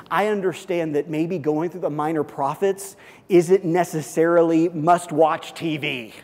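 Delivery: usual speaking voice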